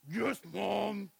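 a growled-up yes mom, no smile; grudging toddler growl. Retold or imitated. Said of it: "She's all growled up".

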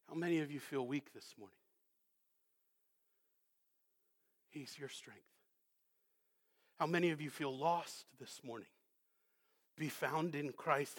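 low, soft pastoral voice; hushed consolation. Real, not acted. Preacher comforting those who feel weak and lost, hushed. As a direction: bring it right down, gently reassuring.

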